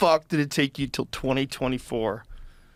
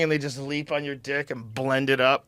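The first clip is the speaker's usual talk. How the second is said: usual speaking voice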